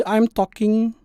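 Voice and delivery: normal talking voice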